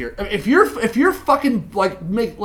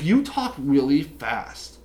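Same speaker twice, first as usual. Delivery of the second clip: slow, low, softer imitation of the date; slow mimicked complaint; 6 semitones lower, 4 dB quieter, much slower than usual. Date complains to him about his talking speed, slow imitation. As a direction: draw out each word in a mock slow complaint.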